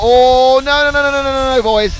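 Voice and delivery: sudden loud groan of no's, pitch jumping up; genuinely horrified as the cars collide; real dismay. Real, not acted. Crash between two cars.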